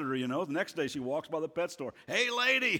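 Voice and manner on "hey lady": high-pitched parrot call; squawking parrot. Acted. Parrot heckles a lady passing the pet store, squawking. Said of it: "the parrot would say".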